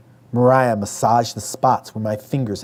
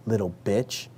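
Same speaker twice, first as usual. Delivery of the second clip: muttered; 8 dB quieter than usual. A narrator's reading. Older daughter walking away, muttered insult under her breath. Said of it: "she muttered".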